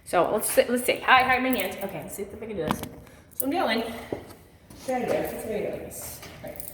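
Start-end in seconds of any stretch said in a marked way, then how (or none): 1.8-6.6 strained effort. Real, not acted. Straining through an exercise.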